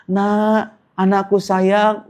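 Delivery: high, slow cooing parent voice; drawn-out, high-pitched endearment; doting parent. Acted. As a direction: coo it like a parent to a child. Parent fondly calling grown adopted child.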